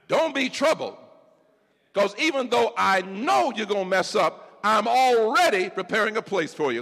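high, loud preacher's reassurance; preaching Jesus' comfort. Acted. Preacher as Jesus comforting Peter, high and loud. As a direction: lift the church up with comfort.

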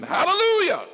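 high ringing shout of praise; shouted hallelujah. Real, not acted. Preacher whipping the congregation into a shout. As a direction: shout it out to the rafters.

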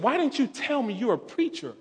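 imitated pleading reproach; retold insistent question. Repeats her question, more insistent.

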